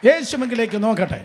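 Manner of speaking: loud, high preaching at full force; ringing pulpit proclamation; fiery preaching. Acted.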